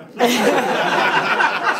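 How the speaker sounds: real laugh; loud laugh. Real, not acted.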